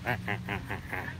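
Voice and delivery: low, soft duck laugh; evenly pulsed duck cackle; laughing duck call. Retold or imitated. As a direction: imitate a duck's laughing quack in short, even bursts. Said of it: "It's always good to hear him go".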